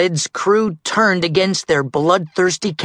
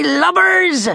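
high pirate holler; pirate shout; 4 dB louder than usual. Acted. Captain Red clearing the gangway, pirate shout.

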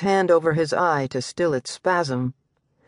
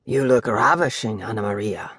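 low growl; 6 semitones lower than usual. Acted. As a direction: growl it at your mistress. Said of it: "he growled".

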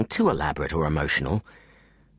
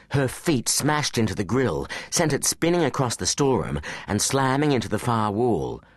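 ordinary delivery

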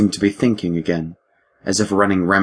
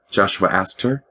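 ordinary delivery

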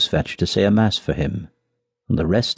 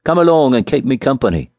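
higher hearty call to a guest; 4 semitones higher, 3 dB louder than usual. A narrator's reading. Miller spotting the little farmer, calling out. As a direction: call out an invitation. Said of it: "he cried".

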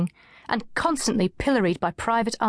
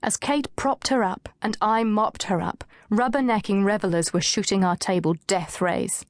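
usual speaking voice

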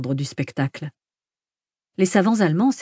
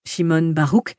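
ordinary delivery

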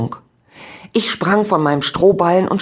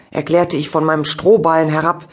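ordinary delivery

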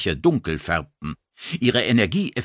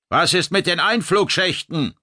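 high raised voice over the din; shouting over noise; 10 semitones higher, 3 dB louder than usual. Acted. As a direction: raise your voice above the chaos. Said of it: "den Lärm zu übertönen".

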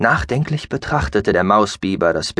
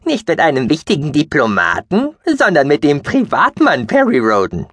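squeaky mouse-beaver voice; 6 semitones higher, 3 dB louder than usual. Acted. Gucky continues the joke.

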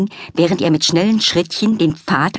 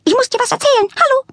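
very high, loud, frantic chirp; chirping bird; 3 dB louder than usual. A narrator's reading. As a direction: twitter like an excited little bird.

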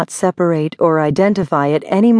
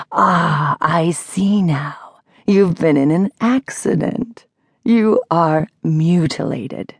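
menacing; slower than usual. A narrator's reading. Menacing caller describes a gruesome vision.

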